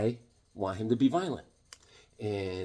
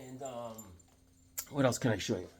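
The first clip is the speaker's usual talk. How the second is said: ordinary delivery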